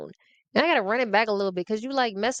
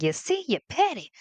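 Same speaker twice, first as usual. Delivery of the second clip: imitating Rome; 6 semitones higher than usual. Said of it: "Rome gonna see this episode like".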